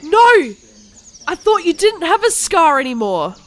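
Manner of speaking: high, loud startled exclamation; shocked cry. Acted. Ebony shocked at Harry's scar, high cry. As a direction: gasp out the disbelief.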